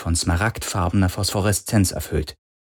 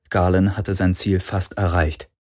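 ordinary delivery

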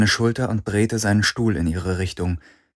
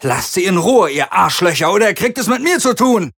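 furious, much higher shout; angry; 10 semitones higher, 4 dB louder than usual. A narrator's reading. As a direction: yell the threat in rage. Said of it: "Und dann wütend".

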